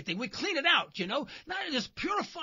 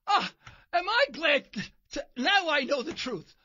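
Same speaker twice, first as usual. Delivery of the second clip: ordinary delivery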